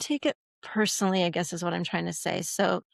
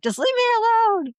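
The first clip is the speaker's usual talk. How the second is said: put-on high, loud snap of a hurt child; hurting kid yell; 6 dB louder than usual. Teen in pain yelling at a parent, high loud snap. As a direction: yell it like a kid who stubbed a toe. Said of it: "You're like".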